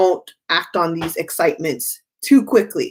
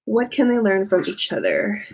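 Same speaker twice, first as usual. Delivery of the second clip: ordinary talk, nothing marked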